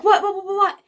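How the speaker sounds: high, loud rapid-fire questions, imitated; retold burst of alarm; alarmed mother. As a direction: imitate a mom startled into panic. Startled mom reacts to gasp.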